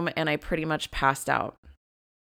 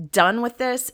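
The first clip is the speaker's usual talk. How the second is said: retold firm anger; 7 semitones higher, 5 dB louder than usual. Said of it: "angry at myself".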